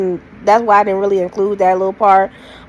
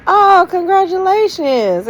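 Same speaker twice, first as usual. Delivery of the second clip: fake congratulations; 10 semitones higher than usual. Congratulating the departing ex.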